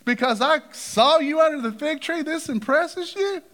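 mock amazement at a small thing; lightly voiced amused Jesus; playful disbelief. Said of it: "Jesus looks at Nathanael and goes".

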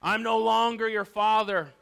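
high, loud warning to stay back; acted growl. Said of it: "only to hear him growl".

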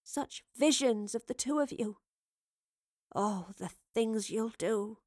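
soft, slow wonder; hushed, tender reverie; quiet. A narrator's reading. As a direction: marvel quietly at a vision. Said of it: "said Evie quietly".

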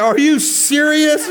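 high, loud, drawn-out disbelief; incredulous. Retold or imitated. Tired traveler to his nosy seatmate, incredulous. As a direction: squeal it in comic outrage, laughing.

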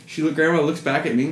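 ordinary talk, nothing marked

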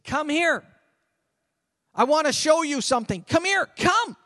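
high, loud, urgent calling over; emphatic summons. Acted. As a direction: call them over with real emphasis. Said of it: "there's emphasis".

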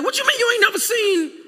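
high, disbelieving mock elder voice; incredulous elder. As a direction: play the shocked old-timer who can't believe it. Elders shocked at youngster.

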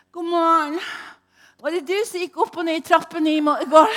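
loud, high, fast, bright greeting; loud, high, quick morning greeting from the housemate; cheerful housemate. Retold or imitated. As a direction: breeze in with a chipper good morning. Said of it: "sier hun bare til meg".

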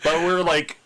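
ordinary talk

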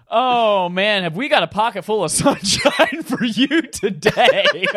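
high, loud and slow, laughing through it; hammed-up teaser. Host teasing the episode like a cheesy promo, laughing.

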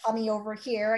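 ordinary delivery

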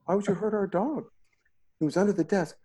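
high, indignant questions; accusing daughter voice. Retold or imitated. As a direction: play the outraged kid defending the dog. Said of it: "Harper's like".

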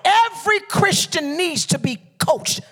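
high, loud, driving preacher delivery; full-force preaching. Real, not acted. Preacher warns congregation about ministry without a coach, shouting fervently. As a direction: drive it home to the whole congregation.

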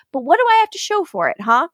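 quick rising rant; fast, high outburst. Retold or imitated. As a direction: rush it up high. Retelling Stefan's outburst.

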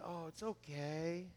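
hushed calm forgiveness; gentle reassurance. Retold or imitated. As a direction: soothe her gently. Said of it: "and I said".

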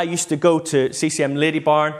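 ordinary talk, nothing marked

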